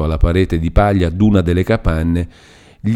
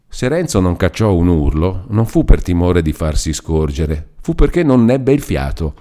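usual speaking voice

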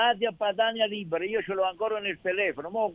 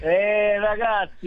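loud echo; 5 semitones higher, 6 dB louder, much slower than usual. Real, not acted. Caller echoes exclamation.

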